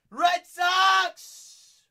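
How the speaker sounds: high, loud fan yell; excited shout. Real, not acted. Host hyping the Red Sox team, loud high shout. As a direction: cheer it like a stadium crowd.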